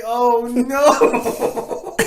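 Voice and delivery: high, loud laughing oh no; laughing dismay. Retold or imitated. Watching friends laugh at waitress, high laughing dismay. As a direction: cringe through laughter. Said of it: "I was like".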